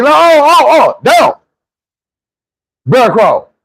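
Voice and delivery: high loud yell; coach shouting. Retold or imitated. Coach yells bear crawl at the player, high and loud. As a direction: holler down the field at him.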